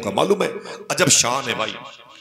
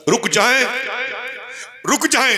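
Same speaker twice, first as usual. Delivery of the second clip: put-on a small boy calling after the caravan to wait; pleading call; 10 semitones higher than usual. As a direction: beg the caravan to stop, voice rising. Child begs caravan to wait.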